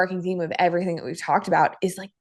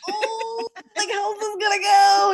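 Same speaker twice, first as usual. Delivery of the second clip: nervous husband; 11 semitones higher than usual. Retold or imitated. Husband nervous after big reveal.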